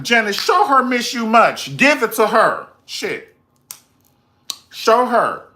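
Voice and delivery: high and loud hype; cheering on. Retold or imitated. A fan urging Janet to dance, loud hyped cheering. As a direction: egg her on like a fan in the crowd.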